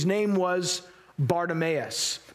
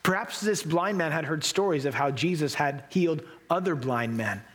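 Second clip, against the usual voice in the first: ordinary talk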